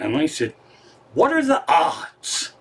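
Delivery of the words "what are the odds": high, slow mock astonishment; playfully amazed; retold amazement. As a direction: stretch it out, pitched up in disbelief. Acting out his amazed reply.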